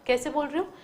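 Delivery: ordinary talk, nothing marked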